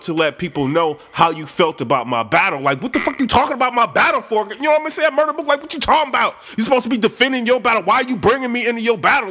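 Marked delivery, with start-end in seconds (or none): 2.6-9.3 much higher, fast, exasperated yelling; mock furious rant. Tay Roc challenges Mook in a high, exasperated rant. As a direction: demand answers like you've been wronged.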